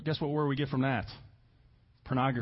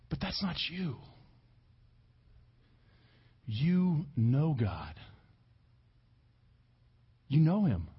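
tender reassurance; 3 semitones lower than usual. Real, not acted. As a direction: speak it gently to them. Tender assurance to the church.

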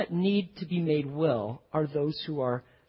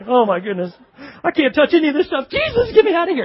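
higher, louder, squeamish panic; stiff, panicky disciples; 8 semitones higher, 7 dB louder than usual. Retold or imitated. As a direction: play a stiff disciple begging to get out, high and loud. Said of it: "stiff as starch going in there like".

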